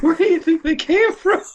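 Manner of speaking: very high, squeaky mock wonder; overwrought fan. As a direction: squeal the question like an obsessed fanboy. Riffing as the obsessed fan.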